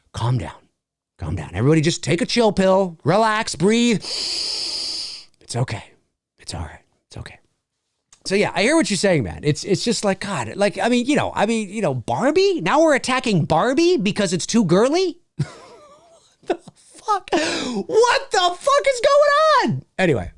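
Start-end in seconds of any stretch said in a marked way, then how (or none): 0.1-1.5 dropping lower and softer each time; mock soothing. Calming overheated viewers, low soothing hush. 4.0-7.4 slow breathy whisper, almost nothing; mock soothing whisper. Soothing upset viewers, slow whispered reassurance. 16.4-19.8 high, drawn-out disbelief; incredulous outburst. Real, not acted. Reacting to Barbie critics, high slow incredulous cry.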